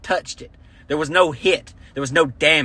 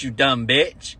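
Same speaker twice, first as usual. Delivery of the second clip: usual speaking voice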